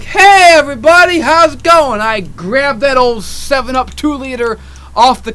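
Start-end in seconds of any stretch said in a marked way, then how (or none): 0.0-2.0 loud, high hello to the room; acted-out, oblivious big hello; cheery greeting. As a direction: call out brightly to a group. Acts out his cheery greeting.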